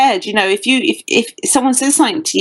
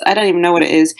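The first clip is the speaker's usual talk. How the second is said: ordinary talk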